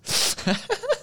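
high squealing laugh; laughing at his own joke. Real, not acted.

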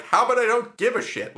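high, loud mock-indignant comeback; aggressive retort. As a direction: throw it back at him, retold with relish. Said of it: "that was really aggressive".

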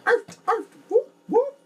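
high, loud barking ad-lib; yelping arf arf whoop whoop ad-lib. Retold or imitated. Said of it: "you're D M X".

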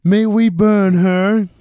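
high, loud mob-member shout; Monty Python villager. Retold or imitated. Villager begs to burn a witch, hammed-up shout. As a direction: shout it high, drawing out the question. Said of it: "That's a reference to, uh, Monty Python".

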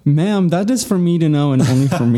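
hammed-up affronted tone; mock offended. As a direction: protest in feigned indignation. Mock offence after being teased.

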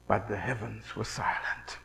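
soft, breathy, unvoiced whisper; hushed whisper. Real, not acted. Preacher telling congregation of silent heavens, whispered. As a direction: let the silence land in a whisper. Hushed line after prophets' frenzy.

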